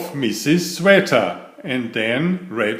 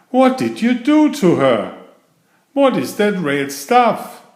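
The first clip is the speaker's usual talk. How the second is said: higher and louder demanding questions; alarmed mother; 9 semitones higher, 6 dB louder than usual. Acted. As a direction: confront the sitter in alarm. Mother confronts babysitter.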